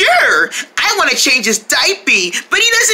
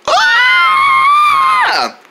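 long, very high scream; hammed-up sustained shriek; falling scream; 3 dB louder than usual. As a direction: scream as if plummeting.

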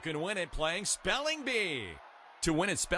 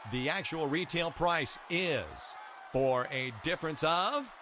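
ordinary talk, nothing marked

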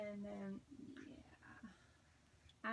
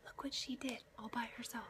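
whisper; 7 dB quieter than usual. Real, not acted. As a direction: hush it, breath only. Baby asleep nearby.

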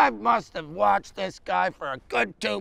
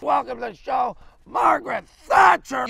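announcer's shout rising on the name; show host introduction; 4 semitones higher, 4 dB louder than usual. Acted. Host welcoming a star guest to the show, announcing loudly. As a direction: belt out the guest's name, high and loud.

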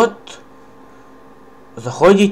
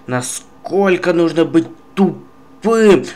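normal talking voice

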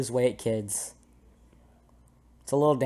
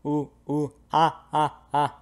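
monkey noises; 2 semitones higher than usual. Pretend. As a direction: pretend monkey hooting. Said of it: "I'm a monkey".

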